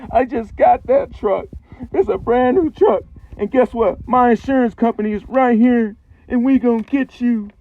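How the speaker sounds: high, loud, furious mock yelling; irate truck owner. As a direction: imitate an outraged owner hollering threats. Acts angry truck owner yelling.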